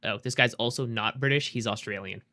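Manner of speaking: usual speaking voice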